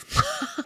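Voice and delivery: brief high laugh; short laugh. Real, not acted. Laughing over an awful memory, short high laugh. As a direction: let out a quick, high laugh.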